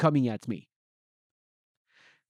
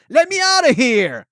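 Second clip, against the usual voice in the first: loud, high shout to be freed; full-force high-pitched demand; baby's demanding yell; 11 semitones higher, 12 dB louder than usual. Acted. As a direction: yell it like a baby desperate to get out. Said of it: "as if to say".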